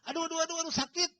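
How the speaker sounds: high, panicky yelps of pain; mock pained cry. Patient yelping before the needle touches her, mock pained cry. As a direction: imitate a frightened patient crying out early. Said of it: "dia teriak-teriak duluan".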